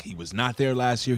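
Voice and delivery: ordinary delivery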